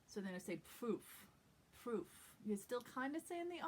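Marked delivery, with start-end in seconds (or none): 0.8-2.1 "proof" with the R softened toward a V; puffed R. Shown as a demonstration. Teacher demonstrates the tricky sound, puffed R. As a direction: puff air through the R of "proof". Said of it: "little puff of air".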